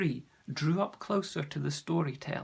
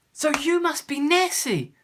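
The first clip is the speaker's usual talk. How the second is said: high, loud exclamation; excited boy; 12 semitones higher, 12 dB louder than usual. Acted. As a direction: burst out with the thrilling realisation. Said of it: "slapped his thigh excitedly".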